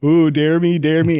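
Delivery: high, loud teasing "dare me"; mock dare. Kid tempted by the fire alarm, high playful taunt. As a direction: egg yourself on, playful and loud. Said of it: "I was like".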